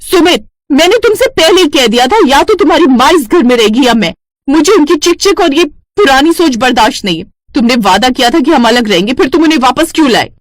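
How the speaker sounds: high, loud furious yell, fast at the end; angry shouting. Acted. Wife shouting at husband about his mother, furious. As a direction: scream at your husband in rage.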